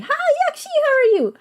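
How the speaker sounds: ordinary talk, nothing marked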